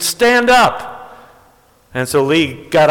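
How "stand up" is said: much higher, emphatic repeat; insistent call. Retold or imitated. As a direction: call it out for someone who didn't hear. Repeats punchline to friend who misheard.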